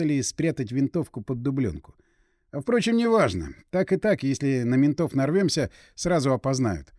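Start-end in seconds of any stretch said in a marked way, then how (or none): none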